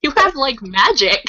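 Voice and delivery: high, mildly incredulous mock reminder; exasperated Ron. Ron reminding panicking Hermione she's a witch, mock exasperation.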